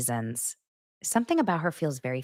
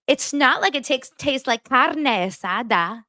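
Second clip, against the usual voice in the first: imitating the other mom; 7 semitones higher than usual. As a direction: mimic her, stretching carne asada. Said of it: "She said it like that".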